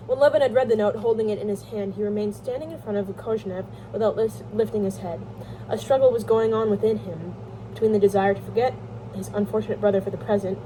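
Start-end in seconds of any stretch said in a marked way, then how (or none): none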